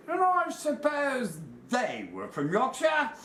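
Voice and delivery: high, loud mock British voice; bad Yorkshire accent. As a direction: parrot a Yorkshireman in an overdone accent. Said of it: "Bloody bad accent".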